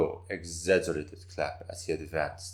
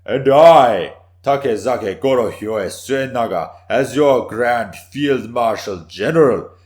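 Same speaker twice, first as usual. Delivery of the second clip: very loud, higher, slow proclamation of a warlord; fever pitch; 6 semitones higher, 13 dB louder than usual. Acted. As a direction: thunder out the final boast. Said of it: "His voice built to a fever pitch".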